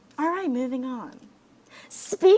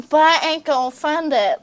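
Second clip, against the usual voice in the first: mocking Trump; 7 semitones higher, 5 dB louder than usual. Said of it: "Next sentence".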